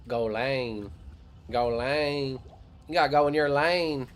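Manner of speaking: mock Kentucky accent, stretched on lane; Kentucky drawl. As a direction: exaggerate a hillbilly drawl, building. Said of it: "that Kentucky drawl".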